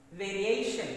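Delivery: "variation" with a loud punch on "A"; stress on second-last syllable. Shown as a demonstration. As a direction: hit vari-A-tion hard on the A. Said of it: "Stress is on second syllable".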